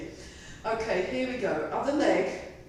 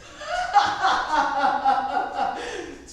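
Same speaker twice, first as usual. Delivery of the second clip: loud, helpless laugh mid-exercise; real laughter breaking out; burst of laughter; 4 semitones higher, 5 dB louder than usual. Real, not acted. Instructor cracking up during the leg exercise, loud laugh.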